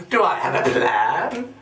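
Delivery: ordinary delivery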